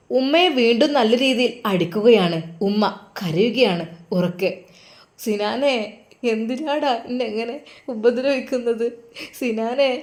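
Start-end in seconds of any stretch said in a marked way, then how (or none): 5.2-9.9 1 crying call. Acted. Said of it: "ഉറക്കെ ഉമ്മ കരഞ്ഞ് വിളിക്കുന്നു".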